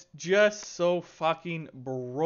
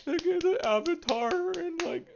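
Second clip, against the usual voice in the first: mocking fanboy voice; 13 semitones higher than usual. Streamer mocking players thankful for free items, high sarcastic falsetto. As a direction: parody a gullible player happily praising the devs. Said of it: "I just can't stand when people are like".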